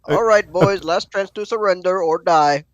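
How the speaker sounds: loud threat. Acted. Fighter threatening smugglers.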